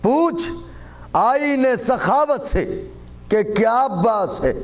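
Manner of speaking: high, ringing declamation in measured phrases; devotional poetry recitation. Acted. Poet praising Abbas before the gathering, high devotional declamation.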